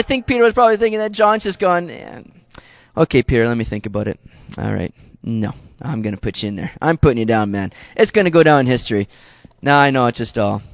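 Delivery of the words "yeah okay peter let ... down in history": a lightly voiced smug John; teasing John. John toying with Peter about the ear story.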